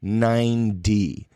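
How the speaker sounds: "nine D" drawn out slowly, two separate words; slow Southern California "nine D" for ninety; nine-D pronunciation. Shown as a demonstration. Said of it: "With my American Southern California ass accent".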